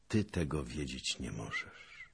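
soft, tender reply; understated sweetness in a hushed voice; sweetly. A narrator's reading. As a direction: let it down gently, quietly. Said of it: "ze słodyczą w głosie".

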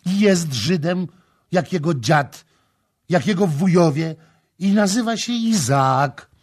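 high, loud, hammered phrases; building shouted declaration; furious shout. A narrator's reading. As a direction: yell each phrase separately, driving it home. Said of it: "krzyczała, bijąc pięścią w stolik".

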